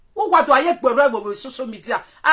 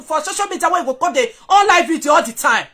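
ordinary talk

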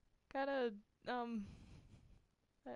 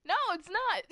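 indignant shout; 12 semitones higher, 9 dB louder than usual. Acted. Heated protest about blind joke.

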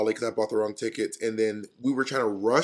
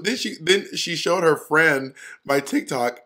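ordinary talk, nothing marked

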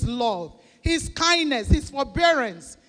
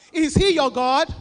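pushed much louder, demanding an answer; louder challenge; 2 semitones higher, 8 dB louder than usual. Shown as a demonstration. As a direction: call it out louder to the congregation.

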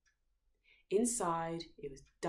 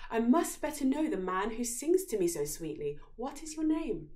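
higher, louder princess pleading; smitten princess; 6 semitones higher, 7 dB louder than usual. A narrator's reading. Lovestruck princess begging the singer for his name. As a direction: press sweetly for his name. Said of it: "she asked him".

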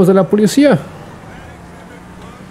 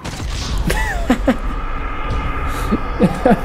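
breathless laugh; 7 semitones higher, 7 dB quieter than usual. Real, not acted. Laughs after dying.

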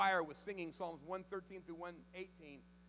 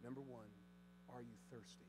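hushed appeal; 3 semitones lower, 16 dB quieter than usual. Acted. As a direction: draw them in close, almost confiding.

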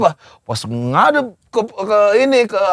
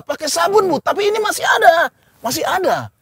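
very high, plaintive mock protest; protesting child; 11 semitones higher than usual. Boy protests to mother, high mock-pleading. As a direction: mimic a boy insisting to his mother, pitched way up. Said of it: "ibunya bilang".

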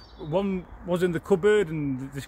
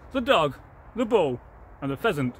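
ordinary delivery, nothing marked

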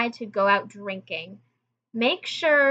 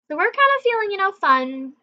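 giddy; 10 semitones higher, 3 dB louder than usual. Retold or imitated. Tipsy feeling, voice jumps up.

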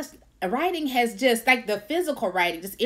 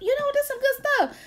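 mock surprise. Voicing her own surprised inner thought.

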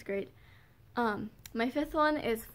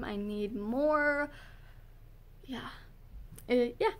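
ordinary talk

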